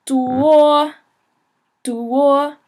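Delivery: duo, do and o blended, level and high; teacher modelling first-tone duo; duo as do plus o. Shown as a demonstration. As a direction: glide do into o on a flat high pitch. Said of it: "saying do and o combined".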